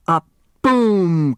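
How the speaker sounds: booming rocket sound; loud firework boom. A narrator's reading. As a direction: burst the boom out. Fireworks bursting.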